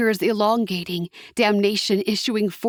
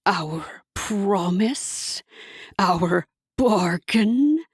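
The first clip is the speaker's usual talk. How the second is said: mocking devil; 2 semitones lower, much slower than usual. A narrator's reading. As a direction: drawl out her hopes. Hasatan mocking.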